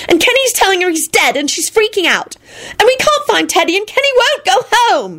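high, overwrought lament; high-pitched frantic whine; dramatic wail. A narrator's reading. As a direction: pile up troubles, building to a wail. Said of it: "she wailed dramatically".

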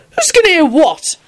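high-pitched insistent question; demanding. A narrator's reading. Drunken Neville wants to know, demanding. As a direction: demand an answer. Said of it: "Neville demanded".

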